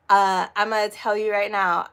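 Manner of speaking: usual speaking voice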